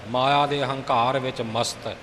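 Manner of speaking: ordinary delivery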